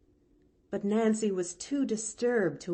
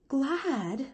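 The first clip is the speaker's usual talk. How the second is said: surprised gasp; 7 semitones higher than usual. Acted. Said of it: "gasped Nancy".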